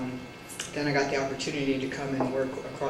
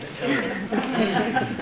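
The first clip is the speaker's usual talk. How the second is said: laughing; 5 semitones higher, much slower than usual. Real, not acted. Laughing at exhausting young colleagues.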